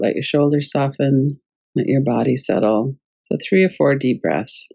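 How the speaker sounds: calm, unhurried guided meditation voice. Acted. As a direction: gently guide the breathing. Leads a breathing practice.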